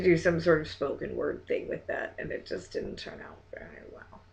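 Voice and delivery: whispered trailing remark; softly whispered verdict. Real, not acted. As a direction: let it fade into a whisper.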